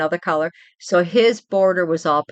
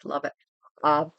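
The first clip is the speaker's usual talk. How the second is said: ordinary talk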